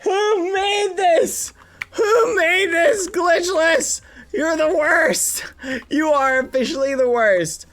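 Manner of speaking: frustrated crying shout. Real, not acted. Streamer cursing the level maker, high crying shouts. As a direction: howl at whoever built this.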